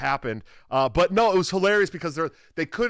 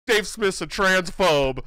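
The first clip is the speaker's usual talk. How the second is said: slow mock sneer, louder; mocking the heckler; 4 semitones higher, 4 dB louder, much slower than usual. The heckler mimicked accusing Dave on air, slow mock sneer. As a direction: drag it out like a smug accuser. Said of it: "and then he's all".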